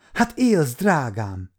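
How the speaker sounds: high-pitched cry, falling on the endearment; tearful cry. A narrator's reading. Girl finding her betrothed alive, tearful cry. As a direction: cry out as if through tears. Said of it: "kiáltotta sírva".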